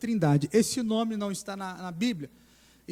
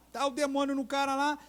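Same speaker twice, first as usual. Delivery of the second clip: ordinary delivery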